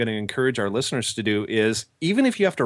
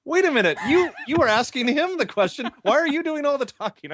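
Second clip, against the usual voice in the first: much higher, amused disbelief with a laugh; mock-indignant onlooker, pitched up; incredulous bystander; 14 semitones higher than usual. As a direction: call him out, laughing and high. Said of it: "the other guy sitting there goes".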